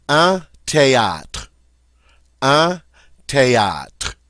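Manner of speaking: teacher modelling "théâtre"; model French word. Shown as a demonstration. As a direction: say "théâtre" clearly for repeating. Teacher models vocabulary words.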